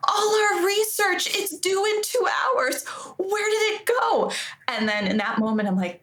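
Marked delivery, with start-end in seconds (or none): 0.1-4.3 high, slow distressed cry; distressed student. Retold or imitated. Classmate losing research before a deadline, high slow distressed cry. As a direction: plead over lost research. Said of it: "another person goes".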